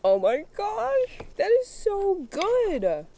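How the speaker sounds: high, loud, slow delight. Real, not acted. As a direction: savour the first bite. First bite.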